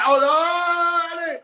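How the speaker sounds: high, drawn-out retold dismay; mock dismay. Guest retelling a reaction to being questioned, mock dismay. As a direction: draw it out in mock alarm.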